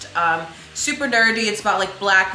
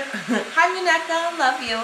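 cheery hello; 10 semitones higher than usual. Real, not acted. Greeting her assistant off camera.

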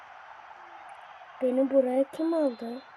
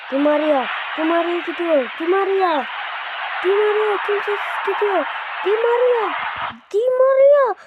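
rising higher and louder each name; building commentary; 7 semitones higher, 4 dB louder than usual. Acted. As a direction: build to a shot.

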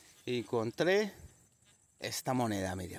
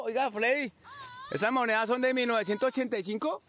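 high, carrying question to a companion; raised pitch, hailing someone far off; calling out; 11 semitones higher than usual. Real, not acted. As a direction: shout the question over to your partner. Calls question to distant companion.

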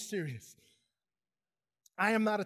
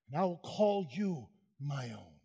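tender promise; 7 semitones lower, 3 dB quieter than usual. Acted. Voicing God tenderly.

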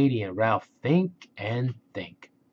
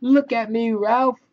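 put-on high, loud gleeful child voice; excited airplane play; 12 semitones higher, 4 dB louder than usual. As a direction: soar like a little plane. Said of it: "says Katy Duck".

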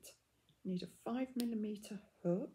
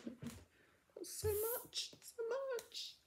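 happy tears; slower than usual. Real, not acted. Overwhelmed by gifted yarn.

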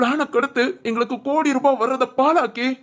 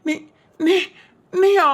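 stunned disbelief; 9 semitones higher, much slower than usual. Acted. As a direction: recoil in shock. Villain stunned to see her. (அதிர்ச்சியுடன் Neeya)

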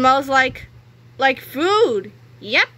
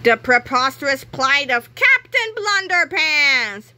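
slow, louder announcer read; dramatic title; 5 semitones higher, 4 dB louder, slower than usual. A narrator's reading. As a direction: proclaim it like a comic cover. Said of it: "comic title read dramatically".

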